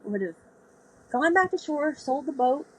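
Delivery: a hint of a teasing old sailor; John's point. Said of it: "He said".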